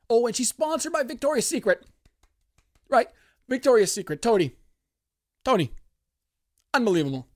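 high, loud amazed burst; excited. Real, not acted. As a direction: gush about the sponsor. Excited about sponsor.

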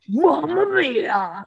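slow, drawn-out "Mamma mia" in a put-on voice; slow Titan voice. Shown as a demonstration. Trying the Titan line again for her cohost, slow put-on voice. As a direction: stretch each syllable of "Mamma mia". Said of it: "Now it sounds like".

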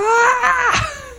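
very high shrieking wail; child's high scream. Retold or imitated. Imitating the screaming kid from the meme. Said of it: "Se pone a gritar".

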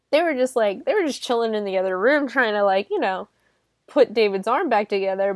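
normal talking voice

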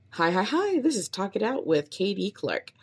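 high bouncy greeting into show name; upbeat podcast intro. Shown as a demonstration. Podcaster rehearsing her show intro, upbeat host voice. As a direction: sell it like a cheerful host. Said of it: "try a few more of these".

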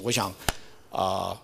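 usual speaking voice

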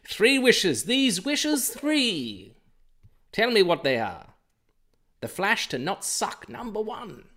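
high, loud booming genie voice. Acted. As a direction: play a grand genie granting wishes. Mock genie granting wishes.

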